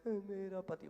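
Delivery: mock-crying wife's voice; imitated tearful wail. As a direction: imitate her sobbing through the words. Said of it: "तो वो रोते रोते बोली".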